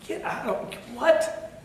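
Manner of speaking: sharp high disbelieving cry; a high "what?" of mock outrage; incredulous outburst. Reacting to "cancer is a gift.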